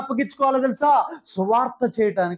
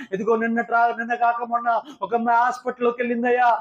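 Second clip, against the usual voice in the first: usual speaking voice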